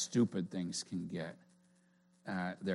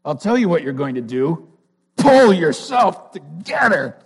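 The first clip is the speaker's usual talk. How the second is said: rising to a shout, higher and louder; Edna; 6 semitones higher, 14 dB louder than usual. Retold or imitated. Edna scolding crying Elastigirl, building to a shout. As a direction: build to a bossy yell, slowing at the end. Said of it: "She says".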